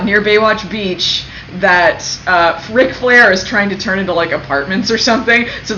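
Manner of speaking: ordinary talk, nothing marked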